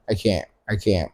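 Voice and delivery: usual speaking voice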